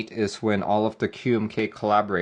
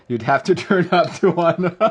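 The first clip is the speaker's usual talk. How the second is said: higher, laughter bubbling through the words; laughing; 6 semitones higher, 4 dB louder than usual. Real, not acted. Streamer reacting to a teasing jab from chat, laughing. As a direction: say it while cracking up.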